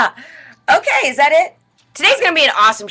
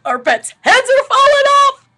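high, goofy movie-quote voice; Jim Carrey impression; 5 dB louder than usual. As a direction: blurt it like Lloyd. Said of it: "It's a line from Dumb and Dumber".

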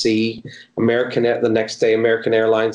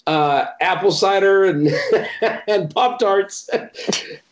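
giggling through the list, voice jumping higher; laughing; 6 semitones higher than usual. Real, not acted. Missionary tells interviewer about their treats, laughing. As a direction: laugh out the menu of comfort food.

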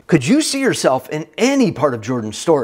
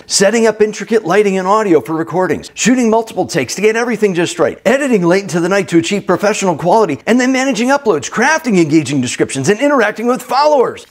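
rushed, higher-pitched run through the tasks; breathless whirlwind list; 6 semitones higher, 4 dB louder, faster than usual. Acted. Listing a content creator's whirlwind of daily tasks, rushed and high. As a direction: rattle off the list with rising urgency.

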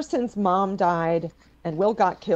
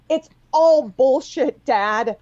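angry outburst; 3 semitones higher, 6 dB louder, slower than usual. Acted. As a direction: let the anger break out. Daughter angry at father.

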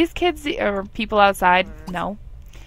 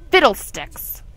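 mock fussy exclamation; 5 dB louder, slower than usual. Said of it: "I love that word".

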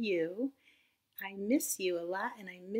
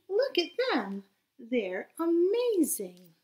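higher, slowed wonder; excited admiration; 8 semitones higher, 8 dB louder, slower than usual. Acted. Slug Norman admiring the snails, excited. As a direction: gush over them.

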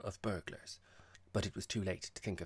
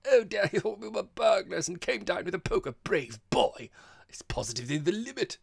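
loud, high-pitched gleeful shrieking; screaming hilarity; 10 semitones higher, 11 dB louder than usual. A narrator's reading. Girl teases poker-wielding host, screaming with hilarity. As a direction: shriek with delight at his bravery. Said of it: "screamed Hermy".

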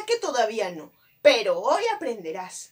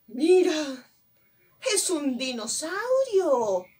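higher, drawn-out mock astonishment; playful amazement; 4 semitones higher, slower than usual. Teacher revealing a dinosaur picture, playfully amazed. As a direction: marvel at it for a small child.

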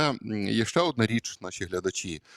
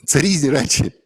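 emphatic; 5 semitones higher, 7 dB louder than usual. Real, not acted. Insisting point to congregation.

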